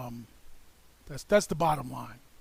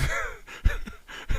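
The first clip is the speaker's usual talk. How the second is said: high breathy laugh. Real, not acted. Laughs mid-quote about Pearlie.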